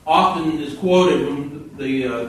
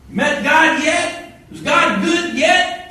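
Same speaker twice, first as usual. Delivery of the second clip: mocking taunt; 12 semitones higher, 5 dB louder, slower than usual. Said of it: "Reggie taunting the rookie".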